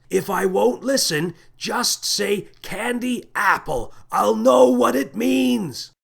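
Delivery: loud, high, drawn-out yell; urgent full-voiced shout. A narrator's reading. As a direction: yell each word slowly across a growing distance. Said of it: "shouted the captain as his voice drifted away".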